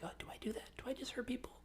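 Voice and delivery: whispered inner worry, retold; high, hushed mock soul-searching; hushed self-doubt. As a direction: whisper it to yourself as a dawning realization. Acting out own shaken inner thought.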